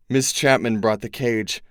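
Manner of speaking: ordinary delivery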